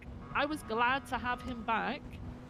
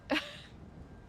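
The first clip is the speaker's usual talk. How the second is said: high airy laugh of disbelief; incredulous laugh; 5 dB quieter than usual. Real, not acted. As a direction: scoff out a laugh. Disbelief at the captain.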